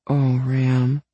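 high, tender female-character voice; softly touched. A narrator's reading. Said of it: "she says softly".

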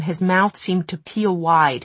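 ordinary delivery, nothing marked

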